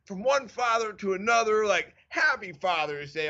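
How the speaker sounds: hammed-up sincere toast between fathers; mock earnest. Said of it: "I'm so earnest".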